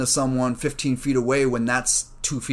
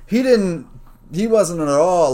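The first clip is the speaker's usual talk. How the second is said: exasperated; 7 semitones higher, 6 dB louder, slower than usual. Real, not acted. Exasperated retelling.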